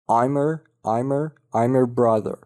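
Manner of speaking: "her" without its h, linked to "I'm"; dropped H. Shown as a demonstration. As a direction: run "I'm her" together as "I'mer". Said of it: "H رو از her حذف کرده". («her» بدون H، چسبیده به «I'm»)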